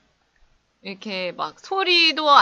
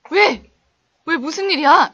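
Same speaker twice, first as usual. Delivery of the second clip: raised, louder mock alarm; alarmed friend on phone; 5 semitones higher, 7 dB louder than usual. Friend answering the panicked caller, acted loud alarm. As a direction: play the startled friend, voice jumping up. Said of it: "그러면 이제 친구가 이러겠죠?".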